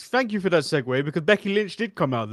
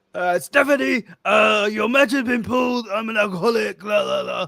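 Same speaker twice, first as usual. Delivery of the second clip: shouted, higher mock boss voice, half-laughing; Adam Pearce impression; 6 semitones higher than usual. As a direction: bark the bad news like a tipsy GM. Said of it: "You be Adam Pearce right now".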